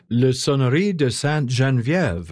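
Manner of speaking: French title in a careful French accent. Shown as a demonstration. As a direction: put on your best French accent for the title. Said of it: "brush up on your French accent".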